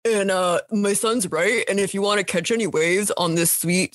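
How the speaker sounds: a hint of a cocky frat bro; frat boy impression. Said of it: "my frat boy impression".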